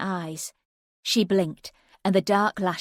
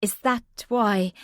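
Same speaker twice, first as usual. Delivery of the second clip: breathless; 3 semitones higher, 4 dB louder, slower than usual. A narrator's reading. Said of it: "She sounded breathless".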